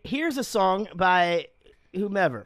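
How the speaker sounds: very slow, drawn-out announcer; mock bandleader. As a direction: stretch it like a cheesy bandleader. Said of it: "Like, it".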